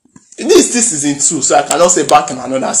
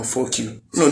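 usual speaking voice

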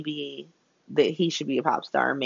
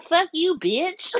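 mock telling off; 11 semitones higher, slower than usual. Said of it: "she's just like".

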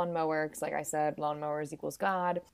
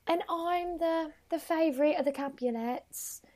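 put-on little gnome princess, pitched up; dainty Juliet; 9 semitones higher, 3 dB quieter than usual. Teller voices Juliet answering Nomeo, high dainty voice. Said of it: "And Juliet's like".

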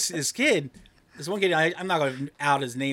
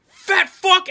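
loud, much higher jeering shout; yelled insult; 13 dB louder than usual. Retold or imitated. Stranger yelling from a car at a pedestrian, jeering shout. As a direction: hurl it out of a car window. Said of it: "somebody just yelled".

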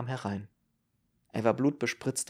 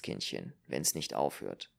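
much lower and softer; subdued refusal; 11 semitones lower, 6 dB quieter than usual. Acted. Doctor turning down Ruth's offer, low and subdued. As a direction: let the voice sink, resigned.

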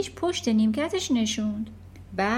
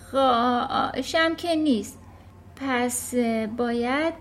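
slow hesitant spelling out; very slowly; 3 dB louder, much slower than usual. Acted. Nervous Eric slowly spelling the word for the headmistress. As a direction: pick each letter carefully and slowly. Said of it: "بعد خیلی آهسته گفت".

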